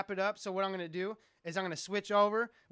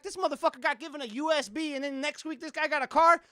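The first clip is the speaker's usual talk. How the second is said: higher, indignant complaint, lightly acted; mock indignation; 8 semitones higher, 6 dB louder than usual. Streamer imitating his outraged self about the prizes, mock indignation. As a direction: whine at the unfairness. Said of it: "I'm like".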